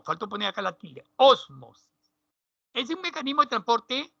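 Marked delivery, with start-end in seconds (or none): 1.1-1.9 Ósmosis with the first syllable Ó clearly stressed. Shown as a demonstration. Teacher to pupils, Ósmosis stressed on Ó. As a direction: lean on the accented Ó of Ósmosis. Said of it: "Tilde en la O".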